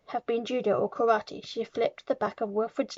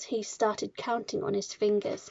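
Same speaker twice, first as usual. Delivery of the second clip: ordinary delivery, nothing marked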